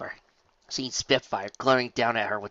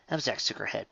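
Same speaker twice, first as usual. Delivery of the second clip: ordinary delivery, nothing marked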